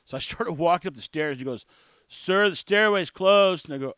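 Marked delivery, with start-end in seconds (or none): 2.1-3.7 loud, slow, firm call; loud and clear. Retold or imitated. Guard finally calling the stairway closed, loud and slow. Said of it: "make him tell me loud and clear".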